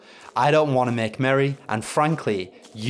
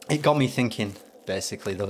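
ordinary talk

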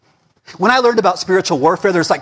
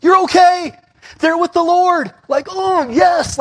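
exultant shout; 10 semitones higher, slower than usual. Acted. Pastor assures grieving listeners their dead are with God, shouting. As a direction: proclaim it like good news. Preaching comfort over death.

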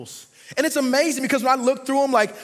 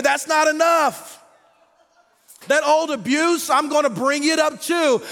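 loud, driving sermon cadence; rising pulpit fervor; preaching at full force; 4 semitones higher, 4 dB louder than usual. Acted. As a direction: preach it hard to the congregation. Preacher builds about baggage.

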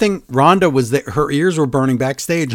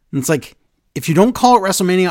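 ordinary talk, nothing marked

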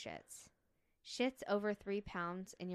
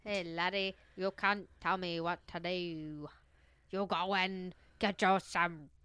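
hammed-up, clumsy Irish accent; bad Irish accent; 4 dB louder than usual. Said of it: "She had an Irish accent".

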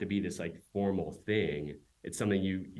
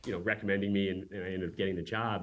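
ordinary talk

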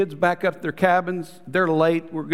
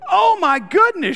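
much higher and louder, slow and amazed; excited exclamation; 13 semitones higher, 7 dB louder than usual. Retold or imitated. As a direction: gasp it out like a thrilled discovery. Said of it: "He comes into the opening and goes".

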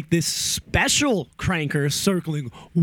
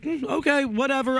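mock indifference; 10 semitones higher than usual. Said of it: "I was just like".

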